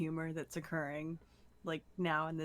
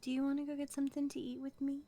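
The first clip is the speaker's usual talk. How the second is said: gentle coaxing voice; 8 semitones higher than usual. Acted. Gentle coaxing of sick character.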